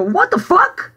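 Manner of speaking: high-pitched indignant exclamation; retold indignant outburst; outraged retort. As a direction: blurt it out in high disbelief. Retelling her outraged reaction.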